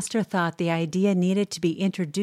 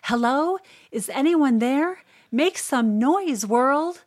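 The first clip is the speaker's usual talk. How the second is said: higher-pitched shout out the door; calling out; 8 semitones higher, 3 dB louder than usual. A narrator's reading. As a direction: call out to the whole world, drawn-out and high. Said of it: "step outside my door and shout".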